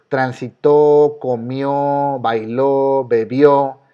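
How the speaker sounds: transitó, comió, bailó, bebió with drawn-out final ó; stress on last syllable. Shown as a demonstration. Teacher lists past verbs like transitó, last syllables stressed. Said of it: "todos los verbos".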